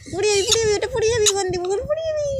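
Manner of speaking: high, rushed warning that it will fall; alarmed warning. Real, not acted. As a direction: blurt the warning fast and high. Warning pet about to fall.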